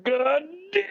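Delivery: high mock exclamation. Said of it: "the first thing you hear is like".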